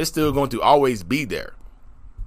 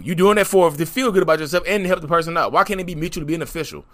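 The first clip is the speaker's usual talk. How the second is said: ordinary talk, nothing marked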